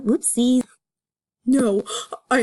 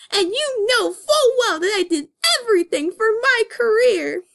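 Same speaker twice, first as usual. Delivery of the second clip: Judy crying; 8 semitones higher than usual. Acted. Judy accuses Nick tearfully, high crying voice. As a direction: push it out through tears, high and hurt.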